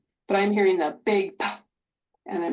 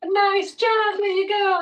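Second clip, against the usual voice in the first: high, loud cheering praise; excited praise; 10 semitones higher, 4 dB louder, slower than usual. Real, not acted. Therapist cheering a toddler, high excited praise.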